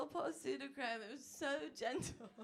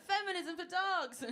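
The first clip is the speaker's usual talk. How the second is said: slow, louder rallying cry; proud declaration; 8 semitones higher, 7 dB louder, slower than usual. Retold or imitated. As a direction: proclaim it like a slogan. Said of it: "I'm like".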